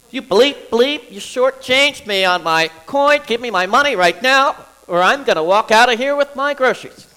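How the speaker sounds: loud, high, furious complaint at the cashier; openly aggressive yelling. Shown as a demonstration. Shortchanged at the checkout, yelling loud and high. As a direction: shout it like you've been shortchanged. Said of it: "So that's openly aggressive".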